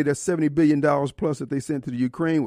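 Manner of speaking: ordinary talk, nothing marked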